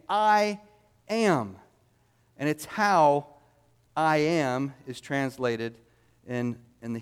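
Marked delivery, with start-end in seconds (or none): 0.1-1.6 "I ... am", each word drawn out for emphasis; emphatic I am. Shown as a demonstration. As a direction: land "I" and "am" separately, with weight. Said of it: "an emphatic way of saying".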